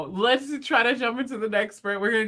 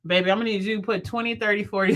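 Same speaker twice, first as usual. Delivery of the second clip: normal talking voice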